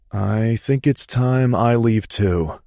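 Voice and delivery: a hint of a resigned friend; lightly voiced Mason. A narrator's reading. Mason's voice, slow.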